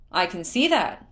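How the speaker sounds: loud, merry shout in reply; hearty calling back. A narrator's reading. Prince answers from the lookout tower, hearty calling back. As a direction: call back heartily with a big swoop. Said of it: "Prince Sidon called back, laughing".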